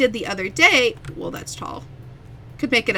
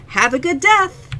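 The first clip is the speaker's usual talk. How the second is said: higher, louder, slow chirpy clerk; cheery customer service voice; 7 semitones higher, 7 dB louder, slower than usual. Retold or imitated. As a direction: sweetly wish them well, too cheerfully. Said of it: "what I almost said".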